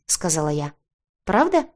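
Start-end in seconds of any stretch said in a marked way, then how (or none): 1.2-1.7 high, eager question; lively, rising in hope; perked up. A narrator's reading. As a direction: perk up with sudden interest. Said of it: "оживился рыцарь".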